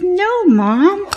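put-on a child turning it down flat; daughter protesting. Daughter refuses raw egg idea.

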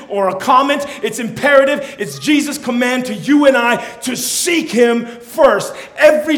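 loud, high sermon climax with drawn-out key words; full-force preaching. Acted. Preacher commanding all to seek God first, full-force preaching. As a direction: hammer the command home to the congregation.

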